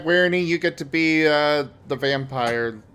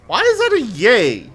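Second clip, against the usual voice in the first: surprised; 9 dB louder than usual. Real, not acted. Baffled reaction to cheering a death.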